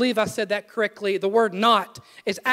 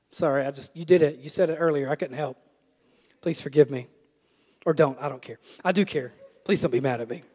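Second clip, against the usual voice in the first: low, softer aside; sheepish mock apology; 10 semitones lower, 5 dB quieter, faster than usual. Preacher begs forgiveness from the person he teased, low mock-sheepish aside. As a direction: drop right down into a muttered apology.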